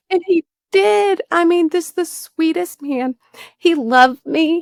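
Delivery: fighting back tears over her stepfather; emotional. Real, not acted. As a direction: voice rising and slowing, choked up. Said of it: "sorry, I get emotional".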